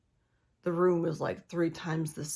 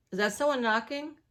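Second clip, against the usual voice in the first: higher, louder "Is that someone knocking?"; alarmed, listening for the door; startled question; 6 semitones higher, 4 dB louder than usual. Real, not acted.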